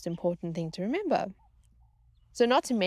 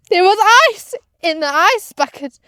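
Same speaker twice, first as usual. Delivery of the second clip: much higher and louder exclamation; loud disbelief; 12 semitones higher, 16 dB louder than usual. Real, not acted. Crew member realizing the ice was there all along, loud disbelieving exclamation. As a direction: shout the discovery in disbelief.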